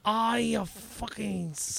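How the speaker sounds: slow, caricatured Japanese accent; mock Japanese. Shown as a demonstration. Rapid-fire accent request from host, mock Japanese. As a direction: crude mock-Japanese attempt, cut short.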